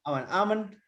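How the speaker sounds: आमन्ड twice, L left silent; correct pronunciation. Shown as a demonstration. Teacher repeating after the student, आमन्ड with silent L. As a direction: repeat आमन्ड as aa-mun, without any L. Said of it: "L किन नभन्ने".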